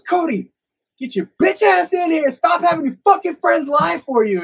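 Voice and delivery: high, loud, furious mother act; imitated screaming mom. Mom orders hiding son out after catching the lie, screaming. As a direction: yell it like a mom who caught the lie. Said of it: "And then she goes".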